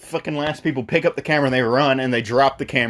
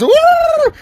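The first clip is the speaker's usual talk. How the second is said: very high, loud "woo"; mock spooked whoop; 9 dB louder than usual. Hosts react to the scary story, mock spooked whoop. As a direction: let out a shrieked whoop.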